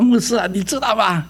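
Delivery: a hint of a chatty friend explaining; mock lively friend telling a story; friend's voice. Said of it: "他说".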